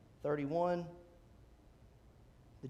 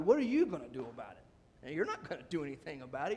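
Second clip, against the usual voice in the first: dismissive, dropping lower and speeding up; belittling; 3 semitones lower, faster than usual. Acted. Older brother belittles David at the battlefield, dismissive. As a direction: brush off the little brother. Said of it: "he belittles him".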